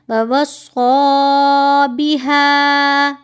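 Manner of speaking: slow chanted ववस्सा with a thick rounded s; drawn-out recitation, ववस्सा thick; correct heavy sad. Shown as a demonstration. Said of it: "स्वाद को आप मोटा करेंगे".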